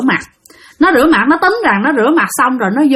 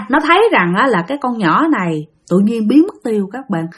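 plain reading voice

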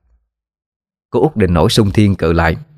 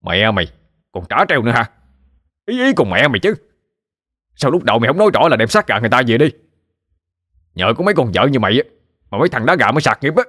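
quick, heated rebuke, mildly acted; angry scolding; 4 semitones higher, faster than usual. Husband blaming wife for his lost cockfights, scolding. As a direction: snap back with rushed accusations.

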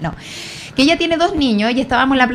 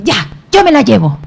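loud, imperious imitation of a man; mock brusque threat; 7 dB louder than usual. Stranger threatens to take a crying girl away, brusque. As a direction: play the stranger butting in loudly. Intruding man's threat, retold.

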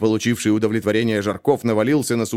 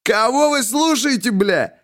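high, indignant shout; hammed-up outraged yelling; 12 semitones higher, slower than usual. A narrator's reading. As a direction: roar at them in outrage. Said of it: "заорал он в негодовании".

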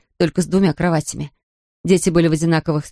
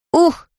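high short yelp; sharp child's cry; cried-out ugh; 11 semitones higher, 4 dB louder than usual. A narrator's reading. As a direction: yelp it high like a small boy. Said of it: "вскрикнул Патрик".

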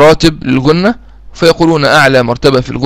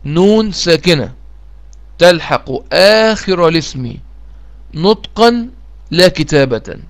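slow, measured definition for students to copy; slow dictation; 2 semitones higher, slower than usual. Shown as a demonstration. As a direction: dictate it slowly word by word. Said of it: "تاني".

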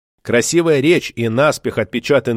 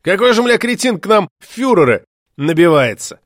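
jeering; 6 semitones higher than usual. Acted. Opponents jeer at candidate.

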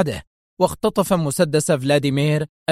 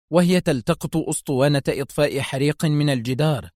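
usual speaking voice

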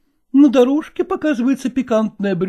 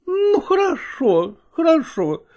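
a hint of a fond wife, higher; lightly voiced young wife; 4 semitones higher, slower than usual. Wife's voice in story.

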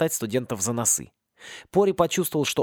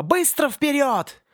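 high, slow shouted order; yelling command; 12 semitones higher, 3 dB louder, much slower than usual. Acted. Gingema yelling at the students to run. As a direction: yell at them to keep moving.

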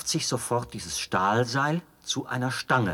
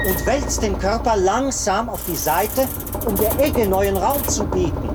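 loud and much higher, straining over the machine's noise; shouting over machinery; 8 semitones higher, 7 dB louder than usual. Acted. Officer proudly explains the running machine to the traveler, shouting over the noise. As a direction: shout every explanation over the roaring apparatus.

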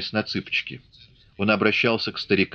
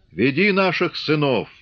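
put-on higher, fervent old man's cry; old Cossack's exhortation; 6 semitones higher than usual. Old Matvey urges Grigory to lead their sons, fervent. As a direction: urge him on like a village elder.

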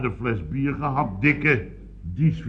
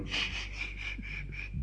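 voiceless shushing hiss; 16 dB quieter than usual. Retold or imitated. He hushes the bar before giving the tip, shushing. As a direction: hush everyone with sharp sst sounds. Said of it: "Overal meteen van".

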